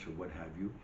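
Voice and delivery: ordinary delivery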